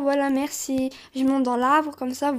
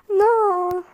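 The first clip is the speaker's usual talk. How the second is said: high, drawn-out wail of defeat; wailed dismay; 5 semitones higher, 3 dB louder than usual. Real, not acted. Player who has just died in game, wailing no. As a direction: wail it like a sore loser.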